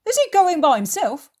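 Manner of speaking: high, loud challenging question; loud, indignant character voice; voiced Peter. Narrator voices Peter objecting.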